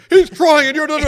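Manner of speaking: high, loud, stern mock rebuke; imitated teacher scolding. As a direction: scold the class like a strict teacher. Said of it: "the teacher would literally scold them".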